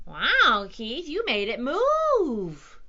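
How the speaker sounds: loud, drawn-out wonder; gasping amazement. A narrator's reading. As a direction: marvel loudly, stretching the words slowly. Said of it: "they gasped".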